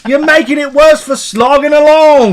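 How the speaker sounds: loud, high mock-British protest; indignant put-on Brit; British accent. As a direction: argue back in British. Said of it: "all gonna talk in a British accent".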